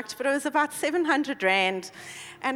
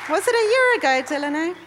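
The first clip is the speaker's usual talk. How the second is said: calling out; 5 semitones higher, 9 dB louder, faster than usual. Real, not acted. Asks Delaney in the crowd.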